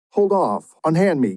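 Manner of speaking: high, loud and indignant, shoving him off; a sharp high protest from a startled guest; indignant outburst. Acted. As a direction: cry out in alarm to be let go. Wedding guest pushes mariner away.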